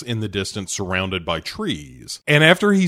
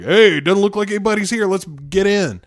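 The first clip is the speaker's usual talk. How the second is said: mock eager kids; 8 semitones higher than usual. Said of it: "They're like".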